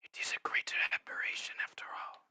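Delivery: hushed secretive whisper. Acted. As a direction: whisper it like a conspirator. Whispering in-character voice.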